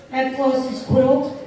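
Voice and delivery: plain reading voice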